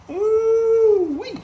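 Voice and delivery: high, loud, drawn-out ooh-wee; big loud cry of approval; excited ooh-wee. Real, not acted. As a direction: whoop it long and high over the food.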